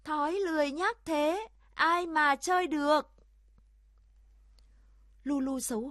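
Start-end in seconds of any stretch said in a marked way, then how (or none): 0.0-3.1 high, loud chanted jeer; raised, emphatic group taunt; crowd chiming in. A narrator's reading. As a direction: echo it like a whole group in chorus. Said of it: "đồng thanh hưởng ứng".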